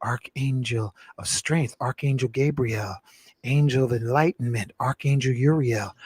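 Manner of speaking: invocation prayer. Acted. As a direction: pray it out like a ceremony leader.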